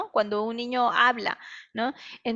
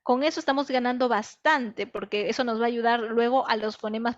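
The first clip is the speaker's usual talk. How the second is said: normal talking voice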